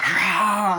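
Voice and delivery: high, loud mock roar; imitated monster roar. Creature coming out, imitated roar.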